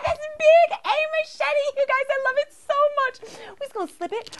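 over-the-moon squeal; thrilled shout. Real, not acted. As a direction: shriek with delight at the machete. Thrilled at doll's machete.

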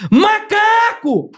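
high, long-drawn cry held back in volume; restrained demo of a drawn-out shout; toned-down tic yell. Shown as a demonstration. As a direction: stretch it out high without fully yelling. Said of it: "Isso é baixinho, né? Pra não atrapalhar".

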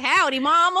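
loud, laughing country twang; playful dog's voice in a hammed-up country accent. Voicing a friend's dog, loud mock country accent. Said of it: "He has a country accent".

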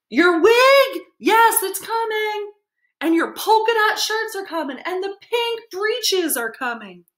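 a town crier hollering the goods; bullhorn shouting. Acted. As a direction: bellow it like a bullhorn. Paul announcing loudly to customers.

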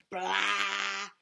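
loud, high mock alarm blast "Blah!"; blaring alarm. A narrator's reading. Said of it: "blared the burglar alarm".

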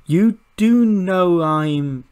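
high, slow, put-on voice; drawn-out high voice; acted Sonya line. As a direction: stretch it out high and loud. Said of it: "and she's like".

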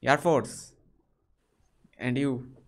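normal talking voice

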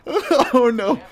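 high, laughing dismay. Real, not acted. Player caught in a fight, laughing dismay. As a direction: laugh it out in alarm.

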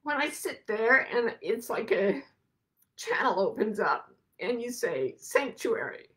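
real tears held just under the words; holding back tears. Real, not acted. Describing her sanctuary, fighting back real tears. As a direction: voice close to breaking, pushing on. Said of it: "I'm gonna try so hard not to cry".

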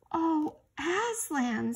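high, loud, drawn-out cry of dismay; despairing. A narrator's reading. Children learn they will never return, despairing. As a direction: wail it slowly, in despair. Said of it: "both together in despairing voices".

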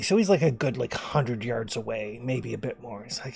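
normal talking voice

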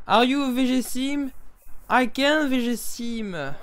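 slow, careful Franglais to the Sims; mock English spoken to Sims; speaking English with a French accent. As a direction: slowly sound out English words to game characters. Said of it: "j'essaie de leur parler anglais".

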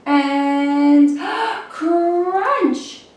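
spring the crunch as a surprise; dramatic crunch. Acted. Wormy sneaks up on the apple and bites, with a gasp. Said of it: "sneaky as can be".

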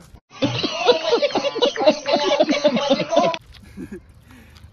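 a big real laugh at his own joke; loud laugh. Real, not acted. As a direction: burst out laughing, high and loud.

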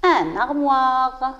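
"Un armoire" drawn out slowly as a whole; slow word model. Shown as a demonstration. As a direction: rejoin the syllables in a slow stretch. Teacher puts syllables back together. (mot modèle, lentement étiré)

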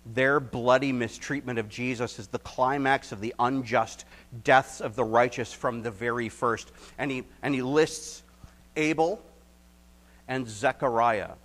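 loud, rising preaching climax; fiery preaching. Acted. Pastor to congregation, loud fiery preaching. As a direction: drive it home to the congregation.

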